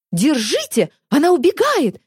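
high squealing alarm; squealing yell. A narrator's reading. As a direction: squeal it out in panic. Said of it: "заверещал Полозов".

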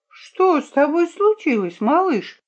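put-on high-pitched, lilting question to a child; concerned question. A narrator's reading. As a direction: ask the little one in a high voice. Виолинка addresses a crying child. (участливый вопрос высоким голосом)

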